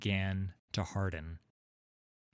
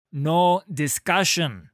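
loud, slow, angry snap; snapped refusal; 7 semitones higher, 10 dB louder, slower than usual. A narrator's reading. Halla silencing Sigvar's protest, angry snap. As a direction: cut him off hard, each word hit. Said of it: "she snapped".

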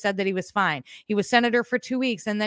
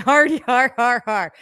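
sarcastic mock laugh; 2 semitones higher, 7 dB louder, slower than usual. Real, not acted. Mocking the stuttering clip.

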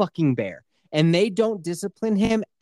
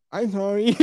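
mock accent. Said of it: "You're gonna reenact their accent?".

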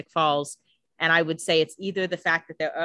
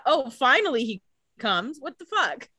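mock exasperation; 8 semitones higher than usual. Mock exasperation voicing Elena.

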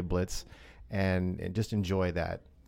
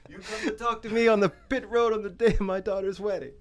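much higher, hammed-up Italian-accented don; mock Italian mafia don; 11 semitones higher than usual. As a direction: parody an offended godfather with an Italian accent. Mock Godfather riff on the accent.

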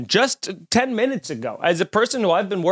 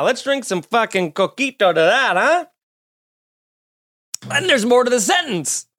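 normal talking voice

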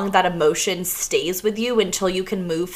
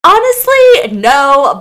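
exasperated blurt; 6 semitones higher, 9 dB louder, slower than usual. Retold or imitated. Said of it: "And I was like".

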